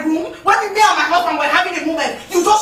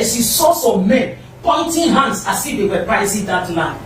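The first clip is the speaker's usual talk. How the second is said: ordinary delivery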